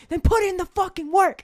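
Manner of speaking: high, loud order; mock bark. Coach ordering his fighter to work, loud. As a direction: hammer the demand home.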